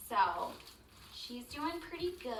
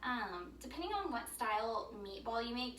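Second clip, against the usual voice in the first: ordinary delivery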